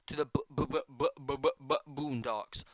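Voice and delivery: bouncy bu-bu-bu stammer; playful stutter. Pretend. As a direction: sputter the word out rhythmically.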